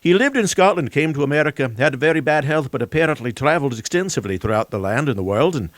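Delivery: a lightly put-on, hammy Scottish accent; a poor imitation of a Scottish accent; terrible Scottish accent. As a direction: do a bad, half-hearted Scottish accent.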